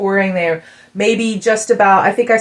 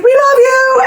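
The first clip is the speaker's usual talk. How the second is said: high, loud fan cry; crowd yelling love; 6 dB louder, slower than usual. Retold or imitated. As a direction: call it out from the crowd. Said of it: "Everybody's like".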